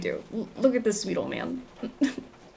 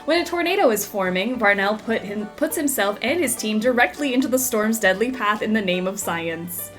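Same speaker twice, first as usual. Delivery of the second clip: TV reporter delivery; news report; 4 dB louder than usual. Acted. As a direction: narrate like a news segment. In-game TV report.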